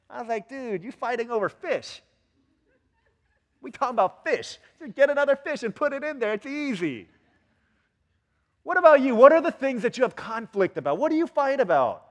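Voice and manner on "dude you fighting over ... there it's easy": high-pitched, comic exasperation; incredulous protest. Retold or imitated. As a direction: reason in disbelief with squabbling parents. Incredulous reply to parents' fish quarrel.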